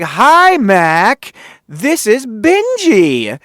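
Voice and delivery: high, slow, beaming hello; hyper excited Benji. Retold or imitated. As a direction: play the overexcited producer. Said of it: "very happy and excited when he talks".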